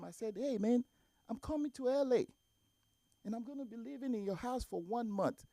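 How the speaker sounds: ordinary delivery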